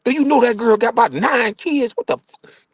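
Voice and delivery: high, outraged mother scolding her son; a shocked mama laying into him. Retold or imitated. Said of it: "my mama kept it real".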